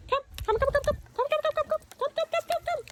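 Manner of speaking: very high, rapid repeated call; coaxing the dog. Real, not acted. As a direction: chirp it over and over to draw the dog in. Calling the dog to come.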